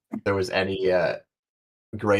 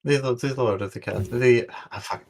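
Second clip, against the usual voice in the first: normal talking voice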